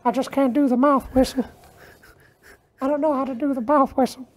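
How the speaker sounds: put-on high, soft creepy-old-man voice; creepy old dude. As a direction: croon it like a creepy old admirer. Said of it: "that creepy old dude from Family Guy".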